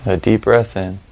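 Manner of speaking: calm, spacious delivery, letting each phrase settle; guided meditation. Real, not acted. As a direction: lead them through the breathing, unhurried and even.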